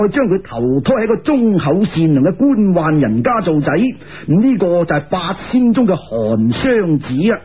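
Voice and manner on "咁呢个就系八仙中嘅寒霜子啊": usual speaking voice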